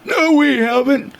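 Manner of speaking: high, drawn-out mock denial; affronted and high, stretched out; retold indignant denial. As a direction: imitate a man huffily denying it. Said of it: "just going ballistic".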